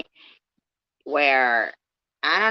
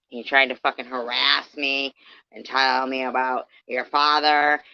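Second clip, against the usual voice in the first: normal talking voice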